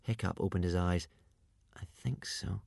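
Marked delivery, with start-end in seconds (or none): 1.7-2.6 very soft, hesitant reply; tentative and hushed; uncertain. A narrator's reading. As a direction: say it softly, not at all sure. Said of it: "he said, uncertainly".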